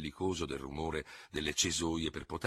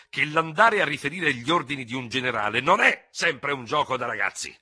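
high, loud scornful tirade; mock and challenge him in a rage; furious taunting; 9 semitones higher, 9 dB louder than usual. Duel challenge, mocking his rival. (sfida rabbiosa e beffarda, acuta e forte)

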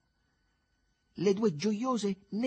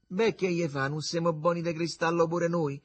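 higher, louder, upbeat retort; optimistic; 7 semitones higher, 5 dB louder than usual. A narrator's reading. Alduccio reassures the grumbling Begalo about their chances, optimistic. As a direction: sound hopeful and sure of yourselves. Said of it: "fece ottimista Alduccio".

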